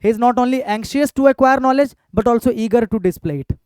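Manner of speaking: very slow, each word drawn out; slow dictating teacher voice; slow dictation. Shown as a demonstration. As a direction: read the corrected sentence slowly. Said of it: "यहां पर कर दूंगा".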